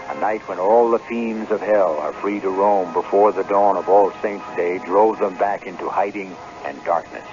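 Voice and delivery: ordinary talk, nothing marked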